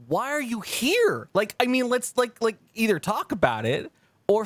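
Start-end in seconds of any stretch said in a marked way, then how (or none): 0.1-3.3 incredulous. Retold or imitated. To an ex who stayed mad for months, incredulous. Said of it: "and I'm like".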